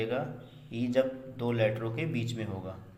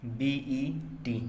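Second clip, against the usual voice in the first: spelled out; 2 semitones higher, much slower than usual. Shown as a demonstration. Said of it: "spelling a word letter by letter".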